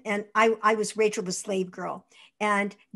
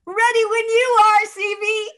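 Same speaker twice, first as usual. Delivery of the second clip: much higher, louder cheerful call; eager cameraman shout; 12 semitones higher, 9 dB louder than usual. Retold or imitated. Cameraman calls down to DeMille, loud and high. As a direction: shout back down brightly from the catwalk. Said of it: "the cameraman said".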